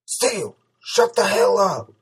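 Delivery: high, loud, strained rebuke; urgent high-pitched strained command. A narrator's reading. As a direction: strain it out, drawing out the order. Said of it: "whispered in a strained voice".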